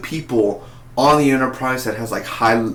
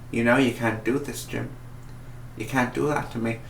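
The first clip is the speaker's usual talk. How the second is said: lightly voiced vintage TV villain; a hint of old staticky-mic delivery, softly put on; Gary Mitchell; 6 dB quieter than usual. Villain pleading with Jim, lightly imitated. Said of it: "I just love the way that this guy talks".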